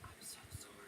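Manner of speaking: barely audible whisper; whispered apology. Shown as a demonstration. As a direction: drop all the way to a whisper to calm them. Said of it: "you just keep lowering your voice".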